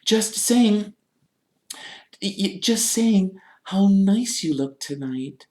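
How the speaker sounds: high, flustered, halting cover-up; Hermione. A narrator's reading. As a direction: stammer out a placating compliment. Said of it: "said Hermione, glaring at Peeves".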